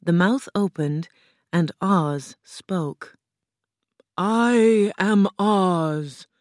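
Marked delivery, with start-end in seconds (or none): 4.2-6.3 loud, very slow, grand proclamation; booming Oz. A narrator's reading. Oz announces himself to Dorothy, booming and slow. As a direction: boom it out slowly, high over your usual pitch.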